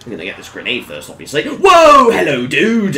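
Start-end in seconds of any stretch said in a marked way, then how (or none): 1.5-3.0 real high, loud yelp of surprise; startled shout. Real, not acted. Gamer startled by an enemy, surprised shout. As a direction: shout out as if jumped, easing slightly after.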